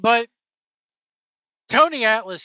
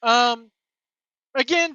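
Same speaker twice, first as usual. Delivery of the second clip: ordinary talk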